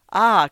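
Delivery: a loud, sudden "Ah!"; curious cry. A narrator's reading. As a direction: exclaim with eager curiosity. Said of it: "cried Markheim with a strange curiosity".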